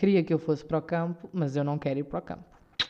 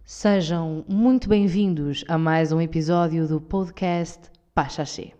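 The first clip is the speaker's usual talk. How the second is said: very slow, solemn formal welcome; more institutional voice; 3 dB louder, slower than usual. Pretend. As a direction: stretch every word like a state announcer. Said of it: "já estão a entrar no mood". (voz mais institucional, muito lenta e solene)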